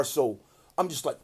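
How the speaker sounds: usual speaking voice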